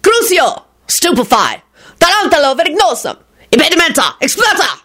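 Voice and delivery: high-pitched battle cries; shouted spells. A narrator's reading. Duelling wizards fire spells.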